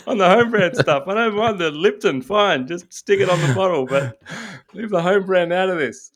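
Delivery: high, laughing put-on complaint; whiny protesting supplier; indignant supplier voice. Home brand makers complaining.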